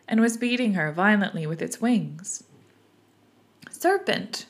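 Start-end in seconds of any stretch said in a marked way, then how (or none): 3.7-4.5 storybook screech of accusation; mild scream. A narrator's reading. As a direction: cry out far higher, at reading volume. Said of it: "screamed the pigeon".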